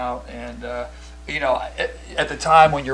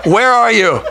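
dispatcher; 10 semitones higher, 11 dB louder than usual. Retold or imitated. 911 operator asking.

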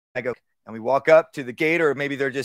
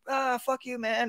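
mock taunt; 10 semitones higher, 6 dB quieter, slower than usual. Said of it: "talking to a neighbor and go".

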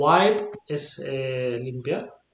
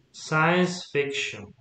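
Science fiction said very slowly; slow model phrase; much slower than usual. Shown as a demonstration. Teacher reads example slowly to student. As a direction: lay out Science fiction word by word. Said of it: "teacher models compound nouns".

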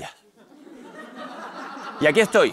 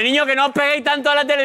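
high, raised nagging mum voice; imitating an exasperated mother; scolding mother; 10 semitones higher, 4 dB louder than usual. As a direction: yell at the kids like mum. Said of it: "decía mi madre".

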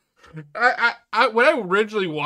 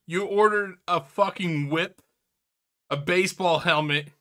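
ordinary delivery

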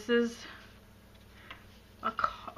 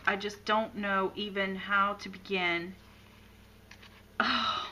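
ordinary talk, nothing marked

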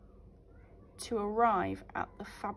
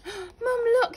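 higher excited call to mum; excited cry; 12 semitones higher, 8 dB louder than usual. Acted. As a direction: burst in with news. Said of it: "he cried".

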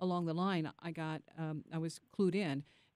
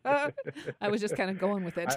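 laughing; 7 dB louder than usual. Real, not acted. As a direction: laugh through the excuse. Laughing at own mistake.

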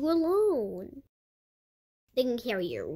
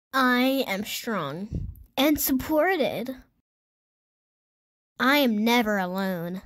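affirmation. Shown as a demonstration. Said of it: "Repeat after me".

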